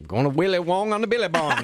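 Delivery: put-on tossing off Aussie slang in a jokey Australian accent; mock Australian accent. Co-host jokingly doing an Aussie accent after talk of Australian accents. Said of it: "what's he talking about".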